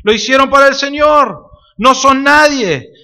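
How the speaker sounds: a hint of Pablo disclaiming himself; voicing Pablo.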